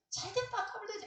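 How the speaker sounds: high-pitched gleeful jibe; mock gloating. Onlooker about someone having a hard time, mock gloating.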